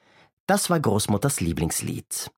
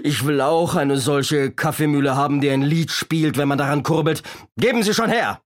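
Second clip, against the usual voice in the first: greedy robber's demand, pitched up; blustering storybook robber, rising to a high call; Räuber Hotzenplotz; 5 semitones higher, 3 dB louder than usual. A narrator's reading. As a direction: insist like a childish villain, building to a shout. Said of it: "knurrte der Räuber Hotzenplotz".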